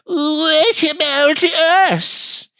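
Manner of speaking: high, slow whining plea; greedy California. Retold or imitated. California whining for its cut of the sale, high and slow. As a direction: whine for a cut, high and drawn out. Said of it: "the state of California went".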